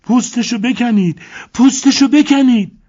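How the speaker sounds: shrill-high, loud screaming plea. A narrator's reading. Elena screaming at the crocodile owners, very high and loud. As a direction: scream it out of control.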